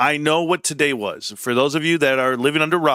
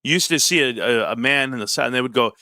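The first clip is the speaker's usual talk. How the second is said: normal talking voice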